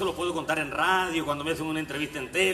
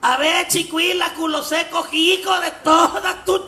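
loud high yell at the official, breaking into laughter; a village woman shouts insults at the clerk; shouting; 12 semitones higher, 8 dB louder, slower than usual. Retold or imitated. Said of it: "pa' poderle gritar bien".

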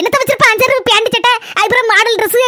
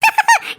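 sly high giggle; 13 semitones higher than usual. Acted. Aside after aunt leaves.

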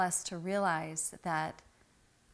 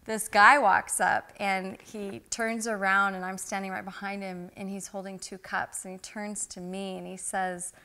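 ordinary delivery

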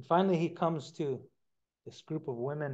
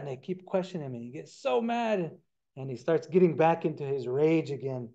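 ordinary delivery

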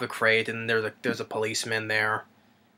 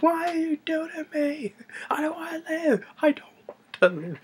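very high, screaming fury breaking into a hoarse hiss; hysterical rage. Retold or imitated. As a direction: rant at the creature in a frenzy. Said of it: "it's like".

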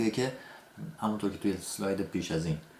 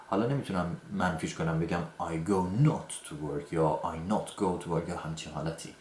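usual speaking voice

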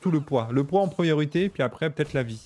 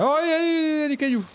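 surprised; 13 semitones higher, 4 dB louder than usual. Real, not acted. Sudden discovery in game.

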